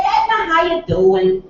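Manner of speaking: phone greeting. Acted. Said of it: "I called her up the other day".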